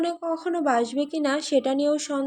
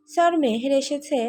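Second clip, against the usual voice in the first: ordinary delivery, nothing marked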